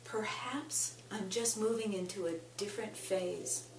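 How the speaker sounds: deliberate phrases, long pauses between each; tapping script. Acted. Coach voicing affirmations for listeners to repeat, measured and unhurried.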